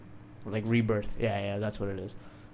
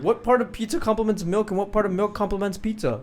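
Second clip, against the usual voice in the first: outraged disbelief; 10 semitones higher, 8 dB louder than usual. Real, not acted. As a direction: refuse to accept it. Arguing against milk and pizza.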